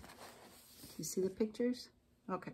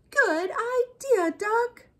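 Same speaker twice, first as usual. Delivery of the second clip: high, slow, happy praise; delighted animals; 13 semitones higher, 3 dB louder, much slower than usual. A narrator's reading. Animals thanking Duck while riding bikes, delighted praise. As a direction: call it high and drawn out with delight. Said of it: "they all said".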